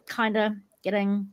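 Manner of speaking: normal talking voice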